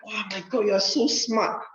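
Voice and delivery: put-on high, gushing admiring girls. Teacher imitating girls swooning over his stories, high and gushing. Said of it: "They say".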